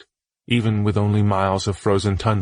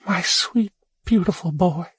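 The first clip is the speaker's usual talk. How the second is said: much higher, tender mother voice; loving mother; 10 semitones higher than usual. Acted. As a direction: call him home like a gentle mother. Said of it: "she said to him lovingly".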